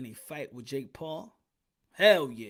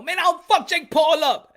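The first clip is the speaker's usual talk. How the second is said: mock threat; 11 semitones higher, 11 dB louder, slower than usual. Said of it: "And I'm be like".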